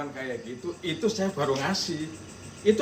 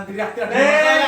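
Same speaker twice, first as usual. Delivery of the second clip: raised, higher angry shout; 8 semitones higher, 8 dB louder than usual. Real, not acted. Homeowner shouting at the vlogger to stop yelling. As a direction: shout her down in anger.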